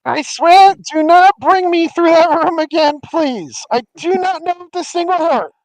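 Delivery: high, loud desperate pleading; pleading shout. Acted. The pained voice begs the party not to return, shouting. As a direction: beg them at full volume.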